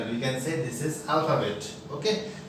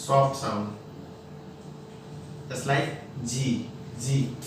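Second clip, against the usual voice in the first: slow, softer जी with little force; soft, gentle G; 4 semitones lower, 5 dB quieter, slower than usual. Shown as a demonstration. Teacher modelling a soft जी. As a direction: say जी softly, slowly.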